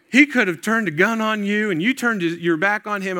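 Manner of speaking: loud, high-pitched worried mother impersonation; alarmed mom. Retold or imitated. Mom warning son about the stranger, loud mock alarm. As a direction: scold like a horrified mom, hammed-up. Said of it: "my mom's like".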